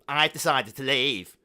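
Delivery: slow, loud declaration; proclaiming. Acted. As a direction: announce it grandly. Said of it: "he proclaimed".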